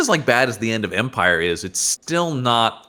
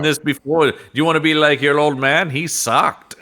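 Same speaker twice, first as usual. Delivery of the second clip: put-on ornery old Obi-Wan; 6 semitones higher than usual. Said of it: "Ornery old man Obi-Wan".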